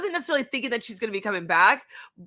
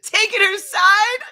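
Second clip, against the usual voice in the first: slow, high, loud incredulous question; hammed-up indignant query, pitched way up; mock outraged disbelief; 11 semitones higher, 7 dB louder, slower than usual. Co-host imitates [name] reacting to [name], mock outrage.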